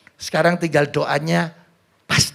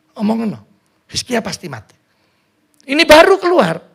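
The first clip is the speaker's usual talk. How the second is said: ordinary talk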